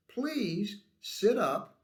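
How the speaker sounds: politely but deliberately direct him; drawn-out, emphatic courteous request; polite instruction. Acted.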